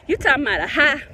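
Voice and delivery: ordinary talk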